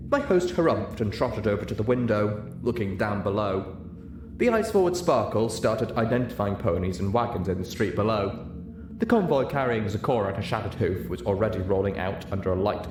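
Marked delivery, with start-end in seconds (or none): none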